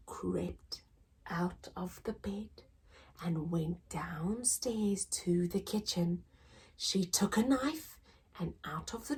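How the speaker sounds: hushed unvoiced narration; whisper. A narrator's reading. Narrating a secret midnight sneak, whispered.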